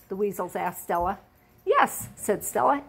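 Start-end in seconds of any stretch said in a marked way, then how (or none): 1.6-2.1 high innocent little yes; very high childlike reply; little girl voice. Acted. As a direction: chirp it like a small child. Stella answers the weasels.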